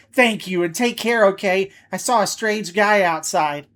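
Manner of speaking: high, loud woman's voice; falsetto customer voice. Acted. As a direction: squeeze up into a falsetto lady. Voicing a customer after falsetto talk.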